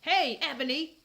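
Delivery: loud call across the yard, voice up; shouted. Acted. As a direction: call her name out loud and high. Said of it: "shouted a voice".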